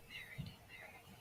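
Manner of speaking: barely audible whisper. Real, not acted. Medium setting the intention for Verity, faint whisper. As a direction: murmur the name under your breath. Medium calling on spirit privately.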